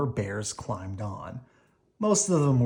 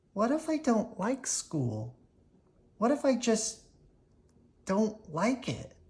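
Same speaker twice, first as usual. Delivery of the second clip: put-on worried child; 9 semitones higher, 3 dB quieter than usual. Sister worries about school.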